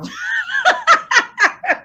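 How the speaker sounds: high-pitched burst of laughter; real helpless cackle, very high; laughing. Real, not acted. Cracked up by co-host's remark.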